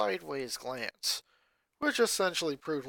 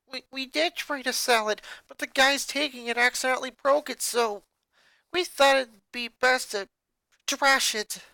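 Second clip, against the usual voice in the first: uneasy; 10 semitones higher, 6 dB louder than usual. Acted. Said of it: "Rita explained uneasily".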